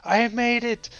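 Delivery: high, loud, gloating yell of a distant friend; yelling from the cliff. Retold or imitated. Victor yelling down from the top of the cliff, high gloating shout. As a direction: crow it down from the clifftop like a show-off. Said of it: "he was yelling at us from the top of the cliff".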